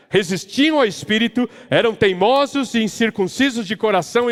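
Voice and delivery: building the sermon's argument with fervour; impassioned preaching. Real, not acted. Preaches at full force.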